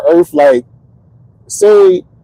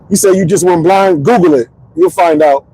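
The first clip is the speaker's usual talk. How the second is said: usual speaking voice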